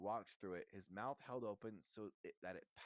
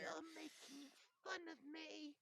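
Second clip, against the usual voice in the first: slow, very high wounded whine; tearful; slower than usual. A narrator's reading. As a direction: whine it slowly on the edge of tears. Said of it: "silver tears welling rapidly".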